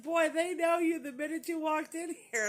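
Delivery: loud, high imitation of a remark; retold amazement. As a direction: exclaim it high, as an amused companion.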